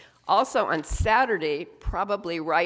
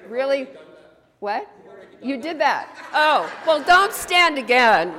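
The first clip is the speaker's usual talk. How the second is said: high, louder, amused disbelief; surprised; 7 semitones higher, 9 dB louder than usual. Real, not acted. Minister sees everyone stand, high and surprised. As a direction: caught off guard by the whole room standing. Surprised whole room stood up.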